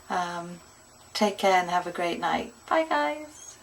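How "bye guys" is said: bright sing-song bye; high cheery bye. Real, not acted. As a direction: chirp the goodbye.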